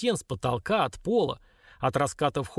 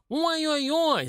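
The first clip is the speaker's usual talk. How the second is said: very high, drawn-out squeal; long squeaky cry of dismay; dwarf squeal; much slower than usual. A narrator's reading. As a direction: squeal in alarm like a tiny imp. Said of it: "завизжал тот".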